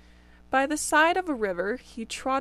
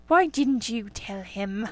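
grumbling rabbits; slower than usual. Acted. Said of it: "they all started grumbling".

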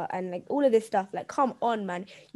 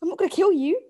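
mock exasperated call; 7 semitones higher, 5 dB louder than usual. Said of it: "I was like".

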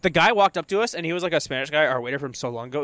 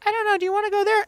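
high-pitched imitation of a woman; imitating her; faster than usual. Wife asking husband at a theme park, imitated in high voice. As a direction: put on a much higher voice for her. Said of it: "and she'd be like".

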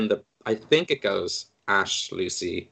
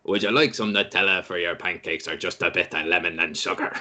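lower, lightly voiced holy figure offering pancakes; a hint of a mock divine host, lower; Jesus of Nazareth; 5 semitones lower than usual. Jesus offers pancake toppings before the desert, low mock voice. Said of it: "As famed Dungeon Master Jesus of Nazareth once said".

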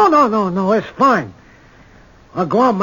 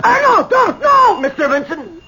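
pleading; 10 semitones higher than usual. Acted. Begging for his life.

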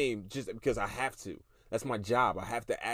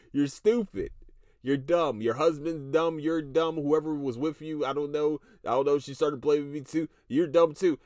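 raised, loud, mocking insults; loud scolding; 4 semitones higher, 5 dB louder than usual. Real, not acted. Podcaster scolding the caller, raised and loud. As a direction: call her dumb, loud and laughing.

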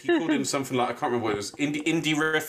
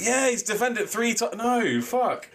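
mock crowd realisation; 11 semitones higher than usual. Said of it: "Everyone's like".

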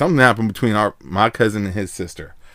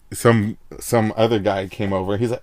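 usual speaking voice

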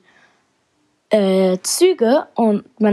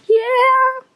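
excited. Real, not acted. Flying fast with jetpack.

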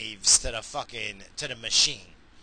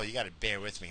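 usual speaking voice

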